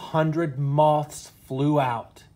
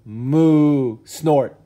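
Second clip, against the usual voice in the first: loud mock moo, then a snort; cow moo and snort; 2 semitones higher, 8 dB louder than usual. Curious cow leaning on the tent, loud mock moo and snort. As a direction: play the monster cow.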